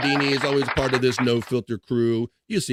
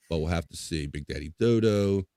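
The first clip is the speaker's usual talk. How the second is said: normal talking voice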